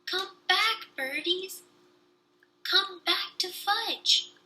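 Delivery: put-on high, stretched-out toddler cry; mild mock scream of a small boy. A narrator's reading. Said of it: "was screaming".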